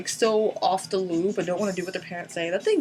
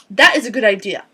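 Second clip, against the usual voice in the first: loud approval; 8 dB louder than usual. Real, not acted. Repeated approval.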